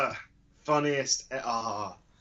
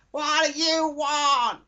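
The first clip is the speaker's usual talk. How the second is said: high, loud, slow snarl as the mother; hostile mother; 6 dB louder, slower than usual. Pretend. Mother snapping at her son, high loud mock voice. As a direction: bark it like a fed-up mum. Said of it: "she's like".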